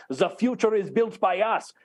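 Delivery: mock Schwab accent, devoiced consonants; imitating Schwab's accent. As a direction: quote it in a put-on German-style Schwab accent. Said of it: "In May, he said".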